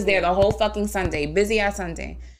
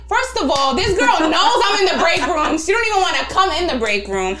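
higher, louder incredulous sass; indignant; 9 semitones higher, 5 dB louder than usual. Retold or imitated. Retelling her annoyance at the coworker, higher indignant sass. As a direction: fire it off, offended and amazed.

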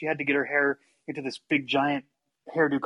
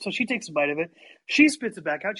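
ordinary talk, nothing marked

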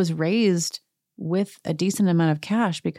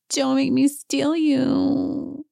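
much higher, slow mock Dorit voice; imitating Dorit; 8 semitones higher, much slower than usual. Host imitating Dorit teasing Sutton's assistant, higher and slow. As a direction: purr it slowly like Dorit.

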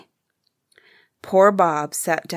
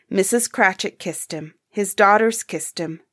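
plain reading voice

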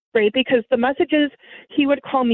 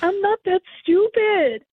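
higher, slower, indignant put-down; retold anger; 9 semitones higher, slower than usual. Hanging up on the scammer, higher slow indignant anger. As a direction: spell it out to someone who tried to fool you. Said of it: "like, really angry".

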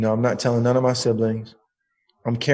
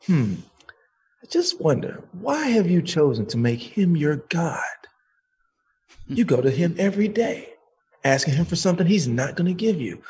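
lightly voiced knowing challenge; a hint of a wondering, questioning God; God's voice; 4 semitones higher than usual. God asking a man why he idolises his debtor, lightly acted reproach. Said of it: "as clear as I'm talking to you".

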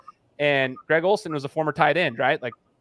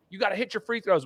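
mock coach; 6 semitones higher, 3 dB quieter than usual. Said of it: "they'll be yelling at you".

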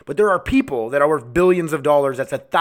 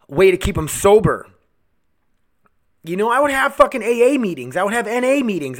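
angry rant; 3 semitones higher, 3 dB louder than usual. Real, not acted. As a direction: rant passionately, building to a louder peak. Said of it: "I'm just angry right now".

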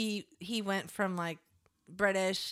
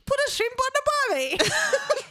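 high, loud, hammed-up Australian; comic Australian caricature, pitched way up; bad Australian accent; 6 dB louder than usual. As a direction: exaggerate a terrible Aussie accent. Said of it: "I got Australian in the end".

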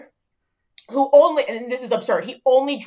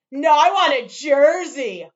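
slow, drawn-out smug punchline; imitating a smirking regular; smug customer joke; 4 semitones higher, 4 dB louder, slower than usual. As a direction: drag out the joke slowly. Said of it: "he's like".

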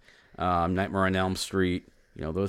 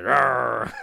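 pirate growl; 3 semitones higher, 8 dB louder than usual. Acted. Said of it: "reverted back to a pirate episode".

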